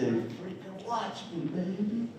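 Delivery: soft, slow, cocky aside; hushed, drawn-out swagger; cool comeback. Acted. Cool comeback after doubters' taunts.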